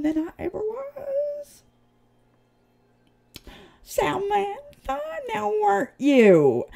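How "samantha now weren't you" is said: sing-song Samantha, pitched high and drawn slow; mocking high sing-song Samantha; taunting call. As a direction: call out Samantha like teasing her into the open.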